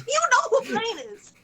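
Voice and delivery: high, loud disbelief; exasperated insistence. Retold or imitated. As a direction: insist it's obvious. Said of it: "I'm like".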